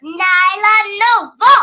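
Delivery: loud, slow drawn-out name call; child yelling the hero's name loudly; excited yell. Acted. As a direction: shout the name triumphantly. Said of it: "she yelled with excitement".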